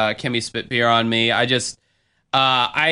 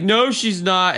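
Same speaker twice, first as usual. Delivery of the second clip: higher mock drunk contradiction; Kimmy; 7 semitones higher, slower than usual. Drunk woman denying a grandma's heritage, mocking imitation. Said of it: "she's like".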